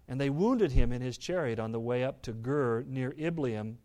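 ordinary talk

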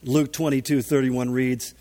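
ordinary talk, nothing marked

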